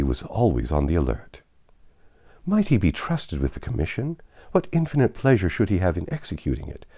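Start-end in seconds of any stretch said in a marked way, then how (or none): none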